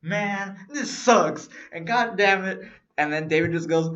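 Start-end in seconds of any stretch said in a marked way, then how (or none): 0.0-2.6 higher, louder mock-dejected groaning; retold dejection. Friend retells his walk back from the ticket counter, dejected. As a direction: play up being bummed out. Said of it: "I was really depressed".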